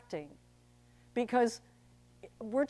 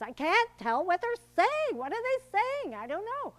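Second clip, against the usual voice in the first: high, loud baffled theatergoer; mock exasperation, pitched way up; complaining audience member; 9 semitones higher, 6 dB louder than usual. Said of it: "the one thing audiences hate".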